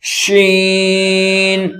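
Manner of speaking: شین with long sh and very long ee; tajweed letter drill. Shown as a demonstration. Teacher models the next letter, slow sustained شین. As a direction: hold شین on one level, drawn-out note.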